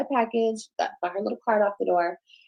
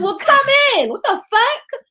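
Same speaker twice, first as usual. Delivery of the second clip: high loud retold yelling; angry yelling; 11 semitones higher, 6 dB louder than usual. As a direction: yell back high and loud. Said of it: "We were screaming at each other".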